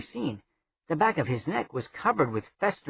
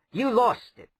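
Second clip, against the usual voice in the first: higher, sharp retort; tart woman voice; 10 semitones higher than usual. A narrator's reading. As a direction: toss it back at him. Lirian retorts to prince.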